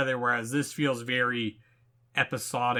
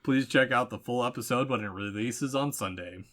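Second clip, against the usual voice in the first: ordinary delivery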